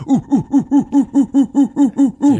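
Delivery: loud, very high ape hooting chant; gorilla hoots. Retold or imitated. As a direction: drown him out with monkey hoots. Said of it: "they all just start going".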